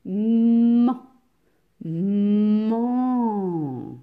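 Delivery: man with a long hummed m, loud; man sounded out slowly, twice; stretched final syllable. Shown as a demonstration. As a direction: hold the m of man, then open into the vowel. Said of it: "la dernière syllabe".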